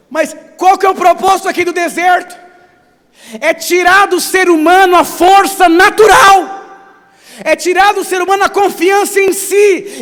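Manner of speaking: shouted preaching, high and loud; preaching at full force. Acted. Pastor preaching to the church, shouting. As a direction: thunder it out over the congregation.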